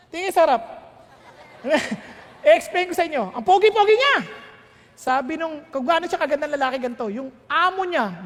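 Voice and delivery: ordinary talk, nothing marked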